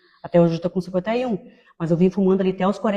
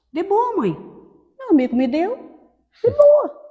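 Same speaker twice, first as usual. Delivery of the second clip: much higher, breezy shrug of a reply; carefree teenage son; 12 semitones higher, 3 dB louder than usual. Retold or imitated. Son brushing off his mother's worry, much higher and breezy. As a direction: toss it off like a careless teen.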